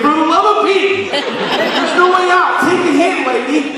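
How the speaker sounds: high, laughing mock despair; comic exasperation, voice pushed way up; trapped and pleading, half laughing; exasperated inner thought.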